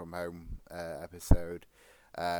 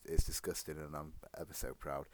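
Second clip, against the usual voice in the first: ordinary talk, nothing marked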